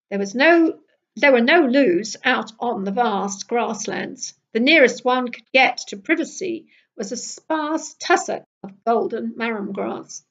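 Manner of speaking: usual speaking voice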